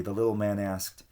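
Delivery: plain reading voice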